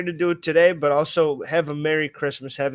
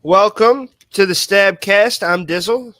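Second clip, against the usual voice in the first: podcast intro; 4 semitones higher, 9 dB louder, slower than usual. Real, not acted.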